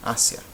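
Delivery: "Asia" as AH-sya, two syllables; stress on first syllable. Shown as a demonstration. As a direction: stress the A of "Asia", ia as one glide.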